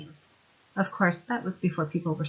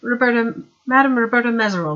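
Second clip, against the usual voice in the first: poised lady; 4 semitones higher, 7 dB louder than usual. Acted. Madame introduces herself.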